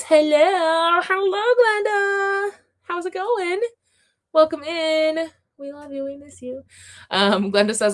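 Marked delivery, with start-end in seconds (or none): 0.0-6.6 high, drawn-out singsong welcome; singsong greeting. Real, not acted. Host welcoming Glenda into the stream, high singsong. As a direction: sing out a long, high hello, softening at the end.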